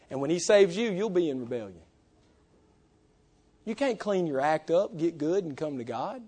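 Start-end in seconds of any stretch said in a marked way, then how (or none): none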